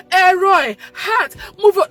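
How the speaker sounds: high, loud desperate call; crying out. Real, not acted. Mother crying out in prayer, loud and high. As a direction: cry out to God.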